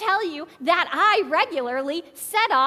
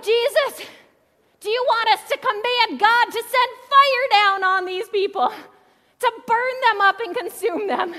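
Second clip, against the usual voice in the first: higher, louder mock indignant plea; mock disciples' plea; 7 semitones higher, 4 dB louder than usual. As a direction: ham up the disciples' eager fury. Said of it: "They're like".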